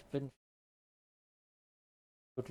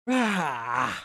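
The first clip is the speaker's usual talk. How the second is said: mock roar; 3 semitones higher, 9 dB louder than usual. Playful roaring while playing.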